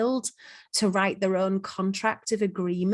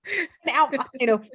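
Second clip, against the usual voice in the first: mock excitement; 5 semitones higher, faster than usual. Playful echo of excited impression.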